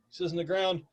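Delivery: usual speaking voice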